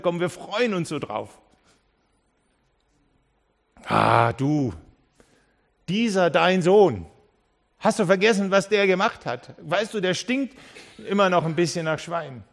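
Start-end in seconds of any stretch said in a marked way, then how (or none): 3.9-12.4 the bitter older brother, half-mocked; a touch of outraged sibling; indignant reproach. Elder brother reproaching father.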